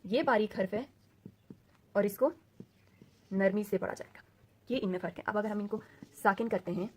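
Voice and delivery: normal talking voice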